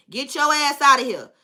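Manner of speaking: usual speaking voice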